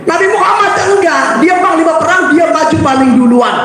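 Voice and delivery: high proud declaration; emphatic exclaim. Real, not acted. The preacher praises the Prophet's courage to the youth, high and proud. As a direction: proclaim his bravery.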